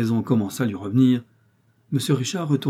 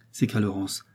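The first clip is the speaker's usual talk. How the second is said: ordinary delivery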